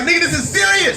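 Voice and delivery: high-pitched urgent yell; exasperated shout. Retold or imitated. Dog urgently protesting in retold quarrel.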